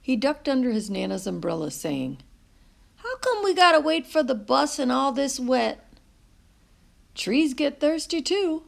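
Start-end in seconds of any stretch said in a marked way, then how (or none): 3.0-5.9 put-on higher, louder boy's complaint; lightly voiced boy grumbling about the wet; child's whine. A narrator's reading. 7.1-8.6 higher, gently teasing Nana; a touch of a grandmother's teasing. A narrator's reading. Nana answers the boy.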